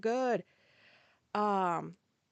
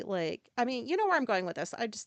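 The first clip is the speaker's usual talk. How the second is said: ordinary talk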